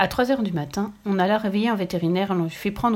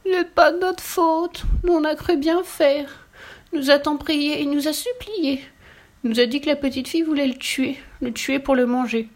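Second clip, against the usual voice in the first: put-on crying children; 7 semitones higher than usual. A narrator's reading. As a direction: plead tearfully in a high child voice. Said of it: "Les deux petites filles se mirent à pleurer". (voix aiguë et pleurnicharde de petite fille)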